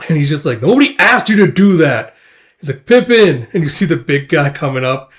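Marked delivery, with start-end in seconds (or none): 0.6-2.1 annoyed retort. Retold or imitated. Guts rebuffs the welcome party. 2.9-3.5 high, loud summons; calling out. Retold or imitated. Comrade summons the big guy, calling out. Calls the big man over.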